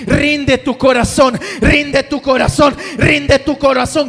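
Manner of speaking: loud, high, driving sermon shouting; preaching at full force. Acted. Preacher calling the congregation to answer God, shouting. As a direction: preach it hard to the whole congregation.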